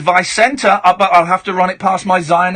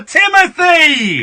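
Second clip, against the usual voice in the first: calling out; 7 semitones higher, much slower than usual. Real, not acted. Host calling caller back.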